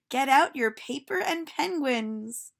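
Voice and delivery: put-on a cheerful teacher calling out to children; silly teacher voice. Teacher speaks.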